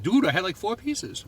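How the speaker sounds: high-pitched imitation of a New Englander; mock Boston accent. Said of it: "I totally sound like a New Englander".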